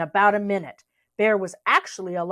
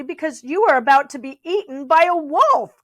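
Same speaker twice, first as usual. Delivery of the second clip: polite shout; 6 semitones higher, 6 dB louder than usual. A narrator's reading. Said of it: "shouted Bear politely".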